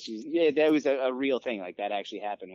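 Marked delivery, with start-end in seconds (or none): none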